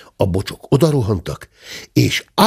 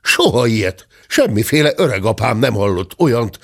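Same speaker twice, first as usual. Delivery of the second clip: ordinary delivery, nothing marked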